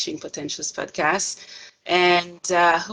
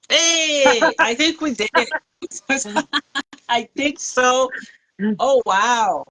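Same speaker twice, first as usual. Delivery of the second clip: relieved, excited and raised; 4 semitones higher, 4 dB louder than usual. Real, not acted. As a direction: cheer that the call finally connected. Connection finally works, relieved.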